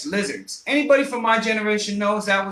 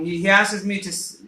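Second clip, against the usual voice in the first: ordinary delivery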